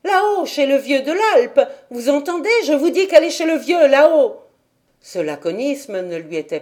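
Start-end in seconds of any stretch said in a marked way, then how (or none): 0.0-4.4 high, loud, fast and exasperated; impatient answer. A narrator's reading. Dete answers the nosy villagers, high, loud and impatient. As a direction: snap it out, fed up with questions. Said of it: "répondait avec impatience".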